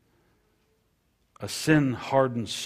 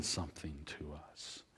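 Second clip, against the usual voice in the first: whisper; 6 semitones lower, 14 dB quieter than usual. Real, not acted. Preacher drops to a whisper.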